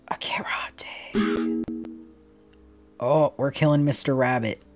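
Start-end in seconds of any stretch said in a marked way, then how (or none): 0.0-1.6 slow gasped whisper; mock-dramatic whisper. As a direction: whisper it like a shocking reveal. Dramatic reveal of pocket's contents.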